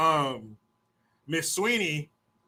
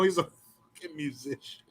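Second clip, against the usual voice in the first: normal talking voice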